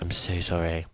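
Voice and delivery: normal talking voice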